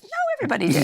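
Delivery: high, sing-song insistence from the aunt; insistent aunt. Retold or imitated. Aunt insisting to her niece, high and chirpy. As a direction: chirp it back firmly. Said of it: "she goes".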